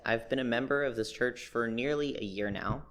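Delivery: ordinary talk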